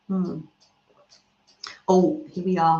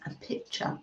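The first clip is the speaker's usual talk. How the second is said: whispered American pitcher; 14 dB quieter than usual. Shown as a demonstration. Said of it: "I love that word".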